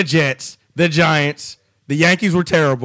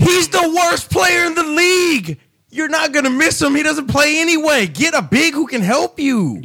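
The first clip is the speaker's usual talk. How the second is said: ranting; 7 semitones higher than usual. Real, not acted. Ranting about the player.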